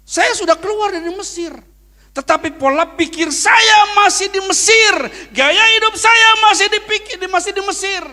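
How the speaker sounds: high, loud preaching shout. Acted. Pastor shouting his lesson at the congregation, high and loud. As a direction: shout the message to the back row.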